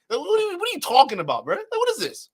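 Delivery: high, disbelieving what-are-you-talking-about; stunned disbelief at the clip; incredulous. Real, not acted. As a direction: pitch up in baffled disbelief.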